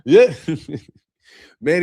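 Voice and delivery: usual speaking voice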